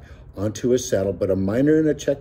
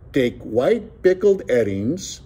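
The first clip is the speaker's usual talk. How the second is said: slow, higher-pitched declamation; declared; 4 semitones higher, slower than usual. A narrator's reading. Joaquin pretending to read a recipe, theatrical declaiming. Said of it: "Joaquin declared".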